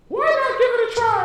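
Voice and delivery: very high, quick fairy voice. Acted. Teacher asking the class about her fairy voice, high and quick. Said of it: "You like the fairy voice?".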